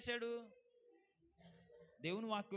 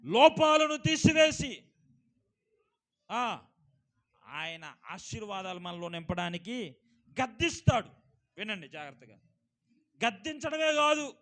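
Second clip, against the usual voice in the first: loud, ringing pulpit voice; fervent sermon delivery, raised and loud; preaching at full force; 11 dB louder than usual. Acted. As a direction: preach it out to the whole hall. Preacher proclaims purpose of rebuke.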